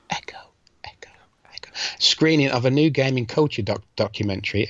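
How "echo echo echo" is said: mock echo. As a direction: repeat "Echo" fading softer each time. Imitating an echo on a name.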